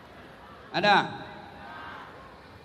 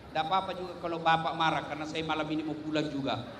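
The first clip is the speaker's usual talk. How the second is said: joking quick aside; 7 semitones lower, 4 dB quieter, much faster than usual. Real, not acted.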